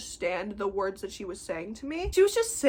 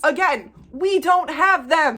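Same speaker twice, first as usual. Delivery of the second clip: loud, slow, high and emphatic; fed up, hammering each word; exasperated insistence; 6 semitones higher, 8 dB louder, slower than usual. Acted. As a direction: spell it out loudly, word by word. Exasperated repeat to the customer.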